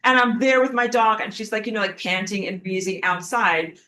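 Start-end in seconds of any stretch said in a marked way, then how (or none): none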